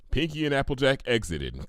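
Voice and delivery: usual speaking voice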